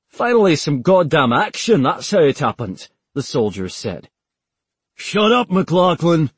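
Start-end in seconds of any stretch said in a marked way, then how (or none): 0.1-2.9 louder, higher Scottish soldier boasting; gleeful Scottish bravado; Scottish accent. Acted. McLaughlin brags to Taylor in a loud Scottish accent. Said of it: "in a thick Scottish accent". 4.9-6.3 sharp, much higher, louder rebuke; chiding. Acted. Fellow soldier scolds McLaughlin with a loud sharp rebuke. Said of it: "chided one of the other soldiers".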